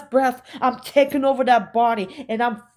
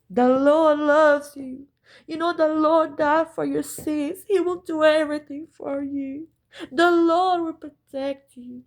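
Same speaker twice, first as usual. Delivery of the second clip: high, sing-song fake-holy preaching voice; mock pious churchgoer; 6 semitones higher than usual. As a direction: play the hypocrite, sweetly pitched up and saintly. Mocking the hypocrites again.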